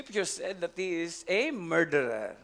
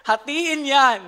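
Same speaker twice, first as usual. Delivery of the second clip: Solomon's loud verdict; 7 semitones higher, 9 dB louder, slower than usual. Retold or imitated.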